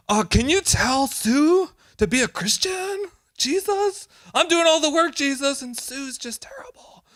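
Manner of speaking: tattling to Jesus, a mock whine; whiny complaining prayer. Believer complaining about Sue to Jesus, mock whiny prayer. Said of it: "how many prayers are going up".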